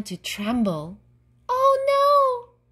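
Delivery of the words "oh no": very high, loud squeal of alarm; terrified squeak. A narrator's reading. Gnome trembles as the dragon comes, squeaking. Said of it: "he squeaked".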